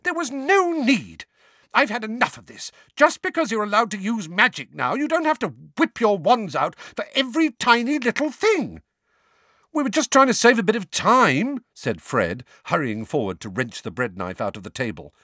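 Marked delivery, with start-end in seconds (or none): 0.0-8.8 high-pitched furious telling-off; angry scolding. Acted. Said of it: "screamed Missus Weasley". 9.7-11.6 put-on defensive teen voice. Fred defends himself to mother.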